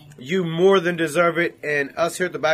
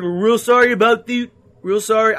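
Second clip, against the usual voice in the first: put-on botched Canadian accent; 5 semitones higher, 8 dB louder than usual. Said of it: "now I fuck up the accent".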